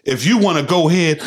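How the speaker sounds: ordinary talk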